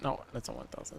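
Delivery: whispered self-correction. Real, not acted. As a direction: hush it, correcting yourself.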